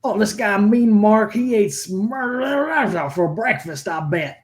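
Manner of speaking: mock unintelligible mumbling; mumbled wrestler impression. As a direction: slur the middle into drawn-out gibberish. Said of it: "he mumbled something about".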